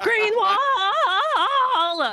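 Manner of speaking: high chanting impression, last word drawn out into laughter; hammed-up high hype-man chant; Spaceman. Co-host capping his impression of a colleague with a high, drawn-out chant. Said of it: "That's my Spaceman impression".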